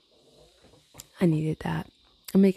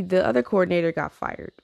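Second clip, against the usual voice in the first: ordinary talk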